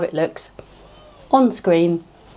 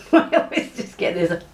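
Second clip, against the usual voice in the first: laughing; 3 semitones higher, slower than usual. Real, not acted. Laughing at mishap.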